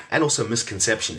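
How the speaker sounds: ordinary talk, nothing marked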